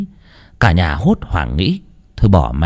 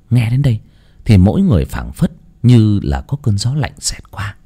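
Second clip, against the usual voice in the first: ordinary delivery, nothing marked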